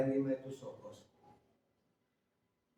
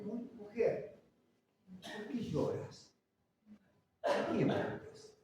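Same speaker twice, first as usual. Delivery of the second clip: gentle angel voice; 5 semitones higher, 5 dB quieter, slower than usual. Acted. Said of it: "que le preguntan a María".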